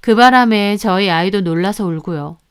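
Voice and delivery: ordinary delivery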